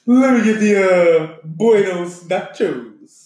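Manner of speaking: slow, drawn-out Buenos Nachos in a mock Spanish accent. Friend imitating the driver ordering at the Mexican restaurant, hammed-up Spanish accent. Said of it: "He sure said it like that".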